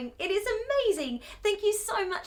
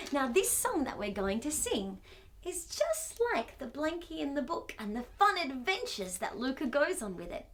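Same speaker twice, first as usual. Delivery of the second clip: usual speaking voice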